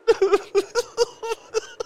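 very high, hysterical laugh mixed with sobs; crying laugh. A narrator's reading. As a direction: laugh and cry at once. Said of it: "she cried and laughed together".

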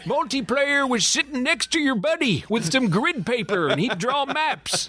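put-on old man voice. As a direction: reminisce in a put-on, high old man's voice. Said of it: "We both got the same old man voice".

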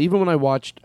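ordinary talk

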